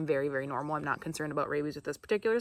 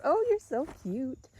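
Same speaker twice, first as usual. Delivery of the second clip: cooing; 9 semitones higher, slower than usual. Real, not acted. Cooing at the skunk.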